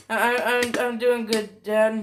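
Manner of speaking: mildly panicked, halting delivery; acted panic. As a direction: stammer it out, pretending all is fine. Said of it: "Deku's still kinda panicked".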